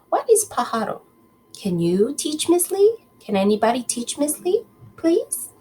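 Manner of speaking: ordinary delivery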